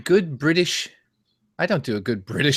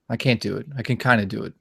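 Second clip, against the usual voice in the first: ordinary delivery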